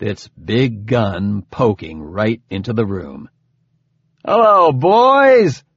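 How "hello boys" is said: loud, high-pitched hearty call; big booming voice. A narrator's reading. Harvey greeting a mess hall of Oban, big booming voice. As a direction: boom it out to the whole room. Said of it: "said in a big, booming voice".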